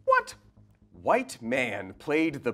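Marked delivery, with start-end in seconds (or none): none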